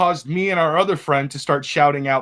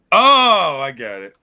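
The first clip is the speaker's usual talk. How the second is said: usual speaking voice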